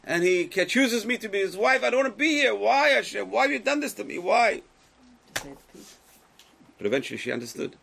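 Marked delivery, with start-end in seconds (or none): 0.0-4.6 high, loud lament, acting out Esther; anguished plea. Teacher voicing Esther questioning Hashem, anguished plea. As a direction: cry out in protest as the chosen wife.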